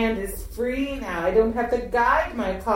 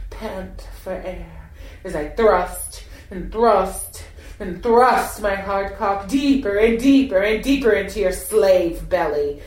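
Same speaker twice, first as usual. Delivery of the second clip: ordinary delivery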